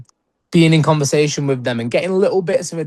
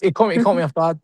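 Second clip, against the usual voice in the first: ordinary talk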